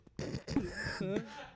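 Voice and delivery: imitated high snort of stifled laughter; spluttering held-back laugh. Comic re-enacts cracking up while going down on her, spluttered laugh. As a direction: splutter as a laugh escapes closed lips. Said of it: "and I start going".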